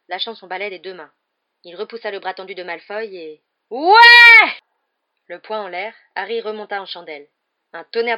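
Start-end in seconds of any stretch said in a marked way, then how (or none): 3.7-4.5 loud, much higher shout of victory; triumphant cry. Acted. Harry grabbing the Snitch, loud high victory shout. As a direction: let out a winning yell.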